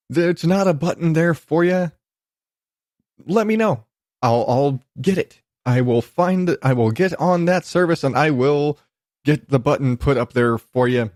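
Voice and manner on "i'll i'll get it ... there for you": ordinary talk, nothing marked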